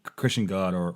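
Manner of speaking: ordinary talk, nothing marked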